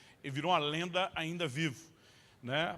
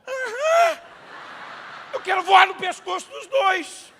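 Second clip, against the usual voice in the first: very high, loud laughing shout; exasperated yell; 13 semitones higher, 11 dB louder, slower than usual. Retold or imitated. Father ranting about the faking son, very loud and high. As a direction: explode in mock fury. Father's furious retold reaction.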